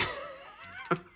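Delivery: breathless giggle; real laugh. Real, not acted. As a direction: crack up helplessly. Cracks up at town name.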